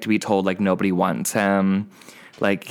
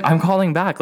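eager yes; 7 semitones higher than usual. Retold or imitated. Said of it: "I was like".

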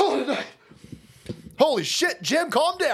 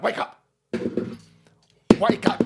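ordinary delivery

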